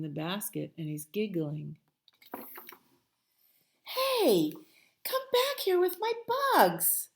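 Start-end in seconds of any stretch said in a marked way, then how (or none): 3.8-7.1 indignant call. Acted. Bear shouting after friends who took his bugs, high and indignant. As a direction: yell after them to come back.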